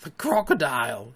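a hushed gasp of dread. A narrator's reading. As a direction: gasp it out in alarm. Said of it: "he gasped".

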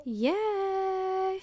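bright, high-pitched cheer; excited. Real, not acted. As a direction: squeal a happy yay. Cheery hello to podcast listeners.

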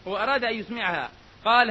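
ordinary delivery

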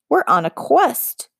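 high, slow squeaky plea; squeaked. Acted. Trembling girl pleading to the witch, high and squeaky. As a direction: squeak it out nervously. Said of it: "Fable squeaked".

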